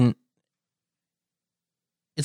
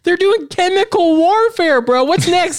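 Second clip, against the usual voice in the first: much higher, incredulous rant; outraged disbelief; 14 semitones higher, slower than usual. Real, not acted. Friend ranting to a friend about chemical warfare, outraged. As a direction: pitch it high in disbelief.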